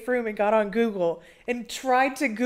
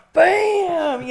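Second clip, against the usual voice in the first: loud, high-pitched sudden impact; punchy storytelling sound effect; imitated slam; 10 semitones higher, 8 dB louder than usual. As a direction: burst out with the bang. Retelling the slam after the giggle.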